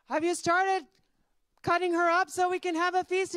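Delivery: high, loud villagers' call. Acted. Villagers coming for the feast, calling out. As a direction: shout the question across the field. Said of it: "They're calling".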